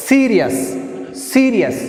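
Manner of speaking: Serious with high, forceful SE, then falling; first-syllable stress. Shown as a demonstration. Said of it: "pronouncing more force".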